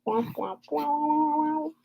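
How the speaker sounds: soft sung womp womp; a quiet mock-sad trombone; droopy womp womp noise. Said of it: "Don't know why I did that noise".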